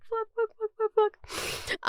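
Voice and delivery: hushed swearing while fighting tears; whispered curses. Real, not acted. Crying on camera over ruined makeup, whispered cursing. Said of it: "I got kind of, like, emotional".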